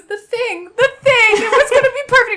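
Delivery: very high, loud hammed-up wail; mock blubbering. Sick boyfriend upset about his ruined plan, mock blubbering. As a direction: wail it like an upset, loopy patient. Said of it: "blubbering mess".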